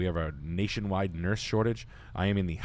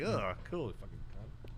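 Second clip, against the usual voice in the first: disgusted mutter; 9 dB quieter than usual. Retold or imitated.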